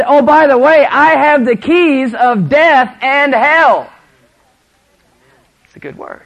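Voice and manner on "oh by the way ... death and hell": high, slow, full-voiced proclamation; triumphant preaching shout. Real, not acted. Preacher proclaims Christ holds the keys, shouted in triumph. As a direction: shout it out word by word. Sermon climax, repeated quote.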